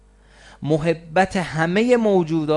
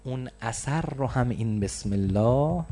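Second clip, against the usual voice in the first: ordinary talk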